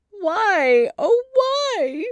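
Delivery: high, loud despairing wail. A narrator's reading. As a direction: wail it out to the heavens. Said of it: "wailed Nancy".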